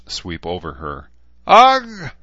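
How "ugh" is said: loud, high strained grunt of a push; straining groan. A narrator's reading. Woman in labour straining loudly as she pushes. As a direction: bear down with a loud strained cry.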